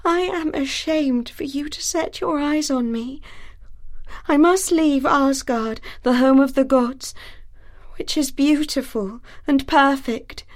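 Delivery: mild tearful plea to leave; tearful Sif. Acted. Sif, ashamed, tells Thor she must leave. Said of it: "she sobbed".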